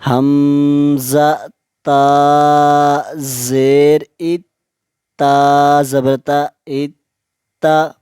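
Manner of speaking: each letter drawn out and even; slow qaida spelling. Shown as a demonstration. Teacher spells slowly, pausing for students to repeat. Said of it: "मैं जब एक हर्फ को पढ़ लूँगा".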